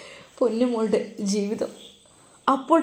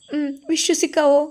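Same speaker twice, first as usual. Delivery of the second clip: put-on doubtful girl; 6 semitones higher than usual.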